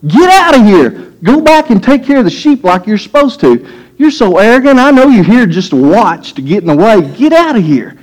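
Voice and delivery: high, scornful big-brother voice; taunting older sibling; mocking older brother. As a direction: sneer it down at the little brother. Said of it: "older brother talking to the young brother".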